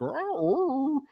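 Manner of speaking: high-pitched imitated dog noise; mock doggy "arr"; dog whine. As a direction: whimper up high like a puzzled dog. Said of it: "the dog is looking at me and he's like".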